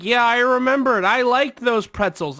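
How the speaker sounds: high, loud gushing imitation; mock excited agreement. Woman reminiscing with customer, high mock enthusiasm. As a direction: agree eagerly in her high voice.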